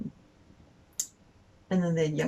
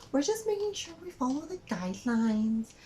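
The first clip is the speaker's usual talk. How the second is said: high-pitched parody of a rule follower; mock officious cashier; 10 semitones higher than usual. Cashier defending the guidelines to customer, mock officious. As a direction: mimic her defending herself, pitched up.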